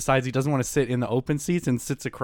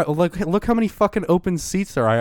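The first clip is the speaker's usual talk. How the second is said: higher-pitched argumentative protest; retold exasperation; 7 semitones higher, 4 dB louder than usual. Passenger arguing about open train seats, re-enacted exasperated protest. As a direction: act out arguing back, exasperated. Acted quarrel, passenger argues back.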